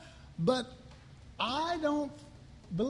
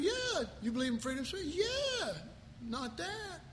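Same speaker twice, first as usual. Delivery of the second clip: much higher yeah, then a soft not that; squeaky agreement, quiet backpedal; mock hypocrite; 6 semitones higher, 4 dB quieter than usual. Pastor playing a hypocrite who backs free speech, squeaky then hushed.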